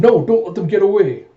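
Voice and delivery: high, loud acted order to give chase; alarmed shout. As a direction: shout urgently to your fellow soldiers. Soldiers shout as the pair escapes.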